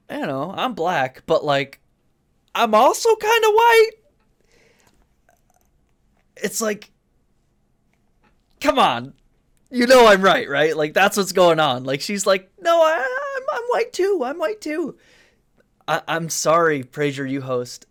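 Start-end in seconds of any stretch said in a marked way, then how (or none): 2.5-3.9 high, whiny mocking voice; mock her in a high whine; mocking impression. Mocking the PragerU host. 12.6-15.0 high, whiny mocking voice; mock her in a high whine; mocking impression. Mocking the PragerU host again.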